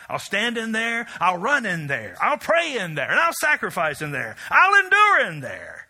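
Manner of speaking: building preached cry. Acted. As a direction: build each line to the top.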